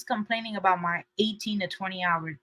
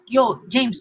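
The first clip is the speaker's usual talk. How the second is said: ordinary talk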